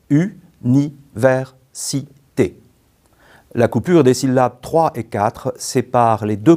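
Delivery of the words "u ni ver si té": U, ni, ver, si, té, each syllable said apart; détaché syllabe par syllabe; split into syllables. Shown as a demonstration. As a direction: spell out université syllable by syllable, with pauses. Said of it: "qui comporte cinq syllabes".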